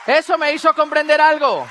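hammering the lesson harder; emphatic repeat. Shown as a demonstration. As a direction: drive the point home. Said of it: "repeats louder".

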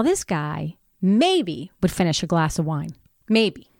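normal talking voice

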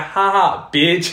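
loud, high taunting laugh-line; mocking gloat. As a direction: jeer at someone who just fell. Retold gloating when kid falls.

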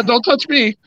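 high-pitched mock protest; mock touchy player; Cutler. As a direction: imitate a player shying away from contact. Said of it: "He's like".

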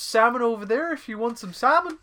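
high, loud cartoon Canadian accent; sing-song Canadian, big and friendly. Doing Rick Moranis in a joke Canadian accent. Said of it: "put a Canadian accent on".

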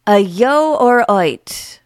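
halting, word-by-word Brummie reading; deliberate, puzzled Birmingham accent read; phonetic Birmingham accent. Shown as a demonstration. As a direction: sound out a Birmingham accent from odd spelling. Reading phonetic dialect spelling aloud.

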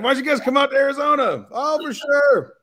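imitating them with a sugary high voice; mock sweet invitation. Mocking invitation to Arizona.

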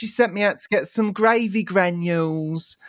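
high-pitched mimicked kid voice; imitating little boy. Retelling how the boy said it, high little-boy voice. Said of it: "this is how he said it".

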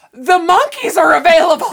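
high, loud, cracking up mid-quote; laughing through quote. Retold or imitated. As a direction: deliver the punchline while laughing. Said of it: "Tony's like".

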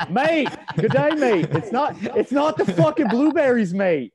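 loud, high mock Australian accent; exaggerated Aussie-accented protest. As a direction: ham it up as a shouting Australian. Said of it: "I think I turn, like, Australian".

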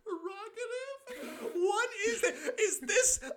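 high squeaky laughter; words squeezed out through laughs; laughing hard. Real, not acted. Laughing fit.

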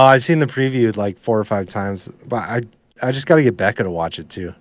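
normal talking voice

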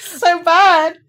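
high, loud cry through giggles; laughing dismay. Real, not acted. Podcaster mocking own pronunciation, laughing dismay. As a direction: wail it through real laughter.

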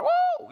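very high, loud whoop; nearly nail the shepherd's whoop; close attempt at the woo. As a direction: tourists nearly matching the call, high whoop. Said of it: "It was like".

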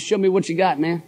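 usual speaking voice

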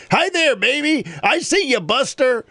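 put-on high, sing-song old drunk calling out to a baby; drunk old man calling. Drunk old man coos at the baby across the restaurant, high and sing-song.

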